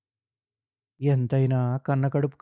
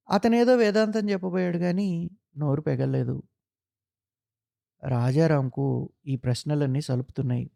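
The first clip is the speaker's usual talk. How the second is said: plain reading voice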